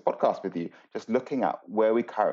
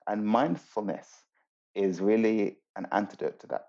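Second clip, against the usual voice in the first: ordinary talk, nothing marked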